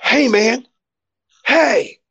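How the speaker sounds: put-on high-pitched drunken hail; drunk stranger. Sunburnt drunk man hailing the poet at the speedway, drunken call. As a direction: imitate a drunk calling out to someone. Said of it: "he's drunk. I know he's drunk".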